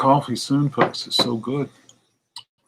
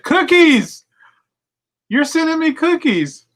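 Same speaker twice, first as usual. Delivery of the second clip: much higher, louder fake excitement; mock delight; 5 dB louder than usual. Telling the phone scammer, high mock delight. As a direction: gush like a thrilled fool. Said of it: "I said".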